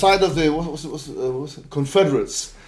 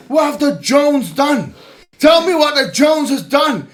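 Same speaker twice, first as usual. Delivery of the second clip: shouting taunt; 7 semitones higher than usual. Real, not acted. Streamer shouting challenges at Alex Jones's family. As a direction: yell the challenge, stretching each line.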